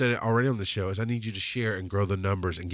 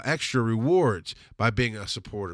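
ordinary talk

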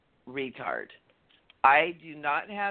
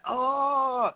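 frustrated groan; 9 semitones higher than usual. Retold or imitated. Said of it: "I started getting that".

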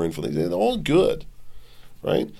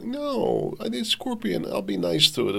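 put-on higher, softer child's voice; imitating a young daughter, higher and soft; little daughter pleading; 13 semitones higher, 5 dB quieter than usual. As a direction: plead like a small girl. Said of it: "my seven-year-old daughter thinking".

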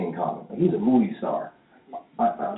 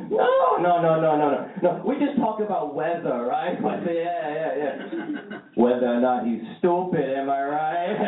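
mock mom denying; 6 semitones higher, slower than usual. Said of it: "She'd be talking to my Chinese girlfriend like".

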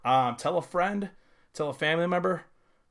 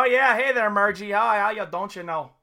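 high mock upper Midwest accent, louder; hammed-up Minnesota voice greeting Margie; playing a Fargo character for laughs; 6 semitones higher, 5 dB louder than usual. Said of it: "No, that was Fargo".

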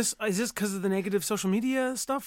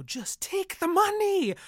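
high, drawn-out mock cry; imagined urging; 13 semitones higher, 3 dB louder, slower than usual. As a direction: plead it high and slow, as if urging someone on. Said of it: "I wanted to tell Echo".

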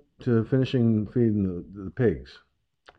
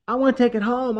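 put-on high, pleading child voice; little girl voice; 14 semitones higher, 4 dB louder than usual. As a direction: beg like an excited little kid. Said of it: "or she'll be like".

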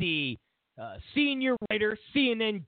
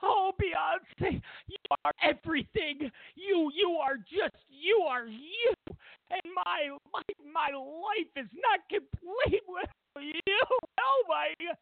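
high, slow, fawning devil voice; a high, drawn-out worshipful voice; gushing devil voice; 11 semitones higher than usual. Pretend. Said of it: "The devil".

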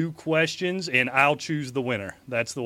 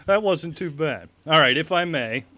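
normal talking voice

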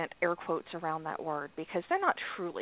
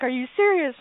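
louder, higher retold disbelief; emotional disbelief; 5 semitones higher, 8 dB louder than usual. Asking partner about the ruling, mock-tearful disbelief. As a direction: act out being moved and incredulous. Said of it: "tearing up".

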